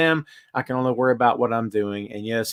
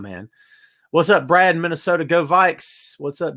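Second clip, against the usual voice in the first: normal talking voice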